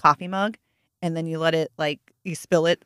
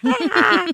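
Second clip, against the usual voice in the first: very high, louder scream; scream back; 14 semitones higher, 4 dB louder than usual. Retold or imitated. Mother screaming back at the startled daughter, high shriek. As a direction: shriek right back in her face.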